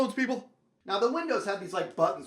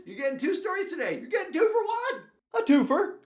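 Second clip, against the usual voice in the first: rising, louder hype for the deal; excited hype; 12 semitones higher, 6 dB louder than usual. Real, not acted. Storyteller hyping his audience, excited. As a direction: sell the two-for-one like a pitchman.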